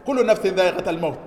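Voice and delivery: fast, level spoken recap of the verse; quick plain repeat. Real, not acted. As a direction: rattle the verse off quickly in the normal voice. Repeating verse after translation.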